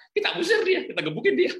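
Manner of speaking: jauh lebih tinggi dan lebih keras, penuh semangat; semangat bercanda. Retold or imitated. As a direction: ayah bersemangat menjawab anak sambil bercanda. Ayah menjawab anak dengan semangat. (ayah bersemangat meyakinkan anak)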